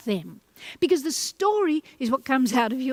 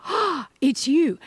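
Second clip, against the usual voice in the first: joyful greeting; 4 semitones higher, slower than usual. Shown as a demonstration. Coach showing how to honour people, thrilled greeting. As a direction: greet them like you are thrilled. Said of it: "quietly say that to yourself".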